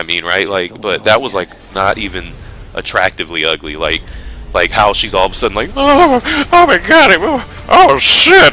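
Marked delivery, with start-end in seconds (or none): 5.8-8.5 high, loud, drawn-out mock freak-out; mock panic. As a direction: shriek it like she's falling, hammed-up. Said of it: "freaking out going down that waterfall".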